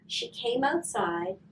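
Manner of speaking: ordinary delivery, nothing marked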